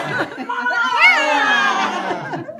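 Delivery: loud high rolling laugh; big laugh. Shown as a demonstration. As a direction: burst into laughter at the partner. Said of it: "And lion laughter".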